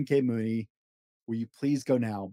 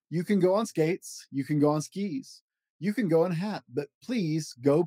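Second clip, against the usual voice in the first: lively read-aloud of a rhyming picture book; storybook voice, playful and rhythmic; reading to children; 5 semitones higher than usual. Acted. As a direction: bounce through the rhymes for a child at bedtime. Reads Dr. Seuss book aloud.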